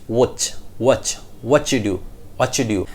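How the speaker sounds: "watch" leading into "whatchu do"; new linking sound. Shown as a demonstration. Teacher drills the new sound softly, linked. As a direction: put a ch in "what you". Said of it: "comes with a new sound".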